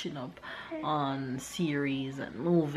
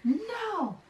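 little pigs voice; 5 dB louder than usual. Acted. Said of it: "cried the pigs".

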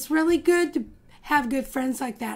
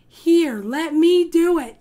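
eager; 4 semitones higher, 6 dB louder, slower than usual. Acted. Holly eager, taking charge.

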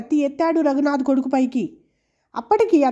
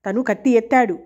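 plain reading voice

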